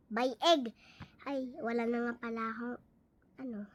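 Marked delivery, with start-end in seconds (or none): none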